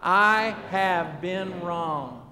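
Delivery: very slow, stretched confession; leading a recitation. Shown as a demonstration. Preacher saying it with the congregation, very slow and measured. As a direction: draw out every word so they can join.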